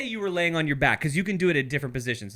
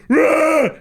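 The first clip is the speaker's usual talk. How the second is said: loud high war cry; battle cry; 10 semitones higher, 11 dB louder than usual. Retold or imitated. Friend voicing the wife charging in, loud war cry. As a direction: charge in with a yell. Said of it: "And she goes".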